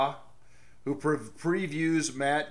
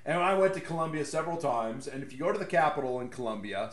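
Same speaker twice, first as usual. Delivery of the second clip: usual speaking voice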